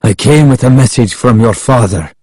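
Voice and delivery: slightly winded man explaining; lightly voiced, out-of-breath messenger; breathing hard. A narrator's reading. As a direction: deliver it as if catching your breath. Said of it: "breathing hard".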